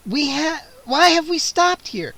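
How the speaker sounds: high loud alarmed question; Kara rising in alarm; alarmed cry. A narrator's reading. Scene: Kara cries out at Gwendolyn in the cellar, alarmed. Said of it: "Kara cried".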